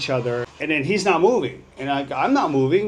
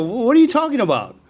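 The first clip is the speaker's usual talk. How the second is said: much higher, fast and louder disbelieving retort; incredulous challenge; 10 semitones higher, 4 dB louder, faster than usual. Retold or imitated. Confronting the stranger who blocked his way, fast incredulous challenge. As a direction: fire the question back in disbelief. Retold sidewalk quarrel with stranger.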